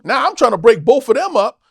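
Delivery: ordinary talk, nothing marked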